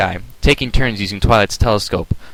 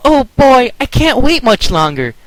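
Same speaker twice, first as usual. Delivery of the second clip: high, louder eager voice; excited; 5 dB louder than usual. A narrator's reading. Twilight on the roof with friends, high excited. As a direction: bubble over with impatience. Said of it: "Twilight exclaimed".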